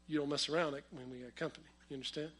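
low, hushed fatherly warning; stern warning. Retold or imitated. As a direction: imitate a dad warning quietly and quickly. Dad's warning to the children, voiced.

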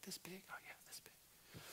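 put-on soft breathy whisper of a little boy; whispered child reply. Little son answering his dad in a tiny whisper. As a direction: whisper it like a toddler showing size.

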